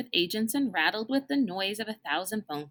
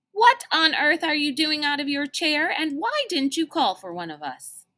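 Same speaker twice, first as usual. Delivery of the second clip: scolding; 8 semitones higher, 6 dB louder than usual. Acted. Number two scolds Mister Benedict.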